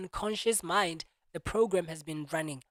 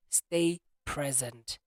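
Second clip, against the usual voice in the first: slow emphasis; 4 semitones lower, much slower than usual. Shown as a demonstration. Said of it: "that's the key word".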